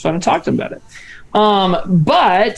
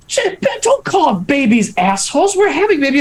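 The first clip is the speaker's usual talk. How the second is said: higher-pitched retold scolding; mock appalled, pitched up after a gasp; scolding wife; 6 semitones higher than usual. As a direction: imitate a partner's appalled objection. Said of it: "I was like, uh".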